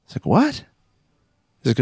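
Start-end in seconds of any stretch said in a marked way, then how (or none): 0.3-0.6 sharp high bewildered what; startled what. Retold or imitated. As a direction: snap up in confusion.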